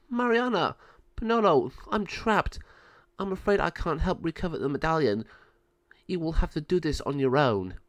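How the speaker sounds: high, urgent plea easing into instruction; trapped mentor. Acted. Lama Sabio trapped, entrusting the birds, urgent. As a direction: call out high, then steady into resignation.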